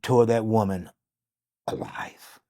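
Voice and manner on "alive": sudden breathy whisper. Real, not acted. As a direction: drop to a hush on the word. Dramatic climax of the story.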